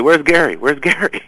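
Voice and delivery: high-pitched imitation of people calling for someone; guests calling out. Guests coming on the show asking for the producer, imitated calling out.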